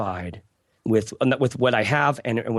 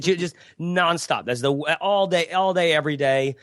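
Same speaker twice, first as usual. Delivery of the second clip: ordinary delivery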